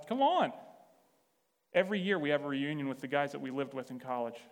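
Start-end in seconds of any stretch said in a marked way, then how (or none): 0.0-0.5 high, loud, enthusiastic urging; emphatic welcome. Retold or imitated. Welcoming Pete back to house dinners, high, loud and excited. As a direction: wave away his doubt with an exuberant burst.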